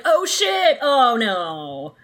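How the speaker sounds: startled, then sinking; startled realization. Retold or imitated. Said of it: "he's like".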